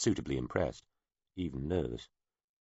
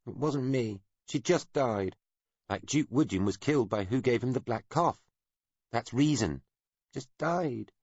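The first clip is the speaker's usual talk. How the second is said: put-on child insisting; 8 semitones higher than usual. A narrator's reading.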